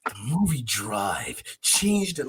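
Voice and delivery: slow, low, solemn documentary voice; mock-serious interviewee gravitas; lightly voiced pompous documentary narrator; documentary talking head. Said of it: "when you watch a documentary and somebody like".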